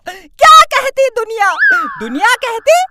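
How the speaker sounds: loud, high rhetorical cry; dramatic shout. Acted. Student defending himself to the teacher, dramatic shout. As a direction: hurl the question at the room. Dramatic speech about father.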